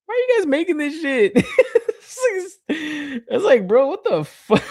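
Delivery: high, laughing rant; laughing disbelief. Real, not acted. Laughing at the silly horn accessory, disbelieving. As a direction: crack up while griping about the toy.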